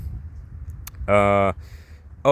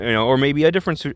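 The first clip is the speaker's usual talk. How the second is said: ordinary talk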